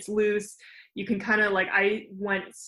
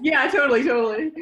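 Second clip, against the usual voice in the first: laughing agreement, louder; 7 semitones higher, 8 dB louder than usual. Real, not acted.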